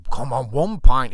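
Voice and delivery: slow, loud, drawn-out plea; mate pleading loudly for just one pint; loud coaxing. Retold or imitated. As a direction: lean on him, stretch out one pint. Friend coaxing him to the pub.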